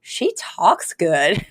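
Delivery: very slow, dim-witted imitation; mock vacant admiration; mindless awe. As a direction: say it blankly, dragging each word. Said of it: "sort of mindlessly".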